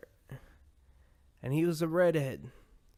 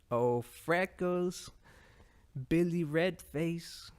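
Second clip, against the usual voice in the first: slow, higher mock Boston heckle; poor Boston accent; 4 semitones higher, slower than usual. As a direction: do a bad Boston accent, drawn out. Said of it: "That's my poor imitation of a Boston accent".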